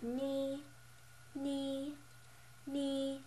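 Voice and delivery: "ni" held high and level; flat first tone. Shown as a demonstration. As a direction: keep "ni" on one high, unmoving pitch. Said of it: "the flat tone".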